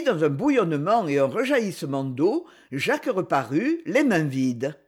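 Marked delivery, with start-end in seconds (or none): none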